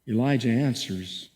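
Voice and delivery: ordinary delivery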